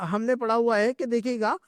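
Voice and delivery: ordinary delivery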